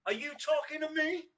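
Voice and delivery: a playful, lightly done De Niro taunt; Robert De Niro impression. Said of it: "I really like your Robert De Niro impression there".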